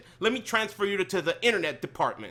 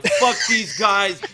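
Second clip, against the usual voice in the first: retold yell; 2 semitones higher, 7 dB louder, slower than usual. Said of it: "yelling at the ceiling".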